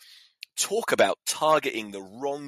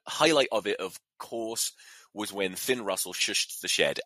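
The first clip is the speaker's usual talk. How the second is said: ordinary talk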